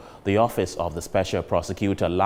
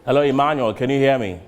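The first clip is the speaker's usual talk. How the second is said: raised voice calling to a caller; 4 semitones higher, 5 dB louder, faster than usual. Real, not acted. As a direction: call out louder to check the line. Host calling guest on Zoom.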